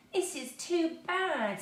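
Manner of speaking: high, slow near-tearful complaint; girl on the edge of tears; almost crying. A narrator's reading. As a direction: whine it, close to tears. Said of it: "almost crying".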